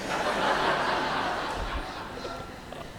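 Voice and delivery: soft breathy chuckle; a quiet laugh at himself. Real, not acted. Preacher laughing at his own shop story, softly.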